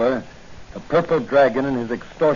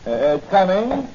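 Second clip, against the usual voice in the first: high, hesitant put-on Chinese accent, broken English; Danny in costume testing his disguise on the doctor; 7 semitones higher than usual. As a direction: play the disguised stranger at the shop door. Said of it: "how do I look in this Chinese costume".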